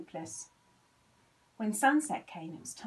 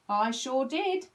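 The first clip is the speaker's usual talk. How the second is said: slow, louder, a touch of a full mouth; 5 semitones higher, 8 dB louder, slower than usual. A narrator's reading. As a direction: talk round a mouthful of breakfast. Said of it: "said Patrick with his mouth full".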